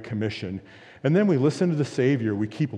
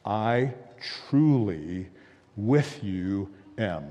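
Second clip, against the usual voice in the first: slow, lowered, word by word; heart-to-heart; 2 semitones lower, much slower than usual. Retold or imitated. Christ speaking heart-to-heart to his disciples, slow and personal. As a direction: leave weighty pauses between the words. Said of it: "heart-to-heart exchange where he says to his disciples".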